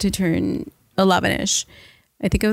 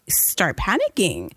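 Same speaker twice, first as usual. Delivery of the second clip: ordinary talk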